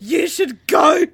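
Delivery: loud slow laughing cry; shouted. Retold or imitated. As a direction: bellow it out, drawn long. Shouted Welsh line.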